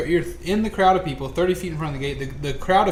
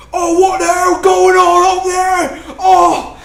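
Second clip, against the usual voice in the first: orc voice; 12 dB louder, slower than usual. Acted. GM voices confused orcs.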